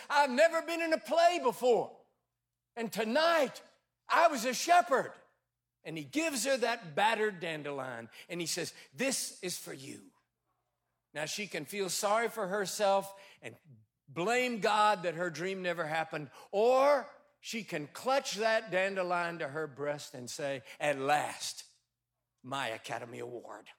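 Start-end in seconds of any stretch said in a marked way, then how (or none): 0.1-5.1 put-on high, excited little boy's voice, louder; proud small shepherd boy, pitched up. 8.9-10.1 shy tender offering. Acted. Boy offering dandelion shyly. 20.8-23.7 tender acceptance. Acted. Woman embracing humble gift.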